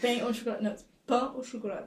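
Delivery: correcting to pain au chocolat in French; French pronunciation. Shown as a demonstration. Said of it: "Not chocolatine".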